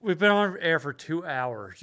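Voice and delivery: normal talking voice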